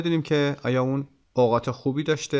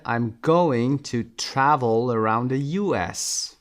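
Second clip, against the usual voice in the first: slow, with GOING, TRAVEL and U S stressed; sentence stress demo; 3 dB louder, much slower than usual. Shown as a demonstration. Teacher models stress on going, travel and U S.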